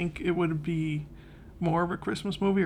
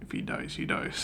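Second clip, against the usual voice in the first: cold rasping evil emperor; 3 dB quieter than usual. Retold or imitated. Drago quote from Rocky Four.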